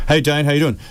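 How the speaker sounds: shout-out greeting. Real, not acted. Calls out greeting to absent friend.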